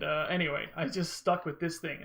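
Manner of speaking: normal talking voice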